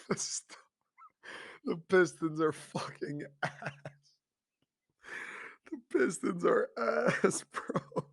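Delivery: slow, broken up by real laughter; laughing. Real, not acted. Host laughing at how bad the Pistons are, slow and giggling. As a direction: keep losing it mid-sentence. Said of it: "I can't stop laughing".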